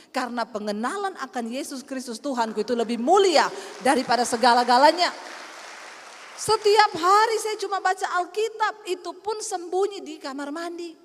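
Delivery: loud, rising sermon proclamation; preaching. Acted. Preacher proclaims to the congregation, loud. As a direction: proclaim it to the whole church. Preaching builds to full force.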